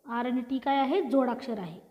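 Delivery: ordinary talk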